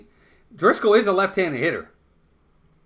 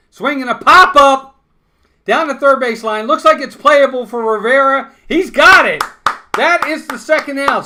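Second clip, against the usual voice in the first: louder, higher live call of a catch; rising excitement on the pop-up; excited play call; 6 semitones higher, 7 dB louder than usual. Real, not acted. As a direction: lift the voice as the ball is caught.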